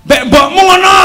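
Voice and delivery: loud high scolding; scolding shout. Acted. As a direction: scold the son sharply. Scolding voice in story. (bentakan marah)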